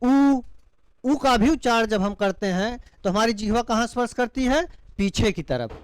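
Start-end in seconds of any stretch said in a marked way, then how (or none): 0.0-0.4 उ called out alone, loud and higher; vowel demo. Shown as a demonstration. Teacher voicing उ for the class, loud and clear. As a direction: sound out उ clearly. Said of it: "पश्च भाग को स्पर्श करते हुए".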